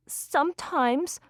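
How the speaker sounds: put-on high, slow small child's voice; little boy. A narrator's reading. Boy answering the woman carrying him, high child voice. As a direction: draw it out like a young boy.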